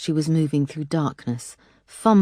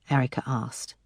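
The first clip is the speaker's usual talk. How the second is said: ordinary delivery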